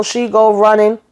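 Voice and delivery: ordinary delivery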